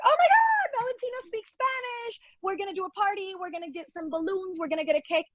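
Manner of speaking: overjoyed mother planning a party; thrilled mom. Retold or imitated. Mom hearing her baby's first Spanish words, thrilled squeal. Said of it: "And I'm like".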